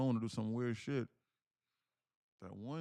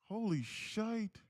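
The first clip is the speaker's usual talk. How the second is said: amazed; 8 semitones higher, much slower than usual. Real, not acted. Reacting to market pop.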